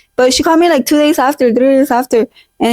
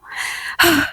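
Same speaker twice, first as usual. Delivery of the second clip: heartbroken sigh; 3 semitones higher, 8 dB quieter than usual. Real, not acted. Heartbroken about the recruiter.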